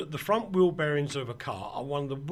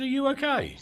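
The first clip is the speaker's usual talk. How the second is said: high-pitched, louder stranger's question; lightly voiced stranger checking in, pitched up; concerned passerby; 10 semitones higher, 5 dB louder than usual. Passing bloke to annoyed man by his car, concerned. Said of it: "he repeated himself in English".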